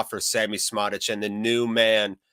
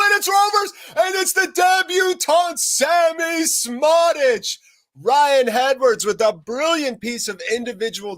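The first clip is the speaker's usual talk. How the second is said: much higher and louder, building to a roaring shout; excited goal call; 6 dB louder than usual. Real, not acted. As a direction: scream the goal like a live commentator. Live goal call in commentary.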